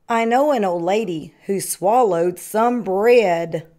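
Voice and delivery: ordinary delivery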